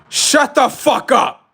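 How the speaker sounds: high, loud shout of shut the fuck up; high-pitched mock rage yell; furious shout. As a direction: scream it down at the heckler. Yells at imagined heckler.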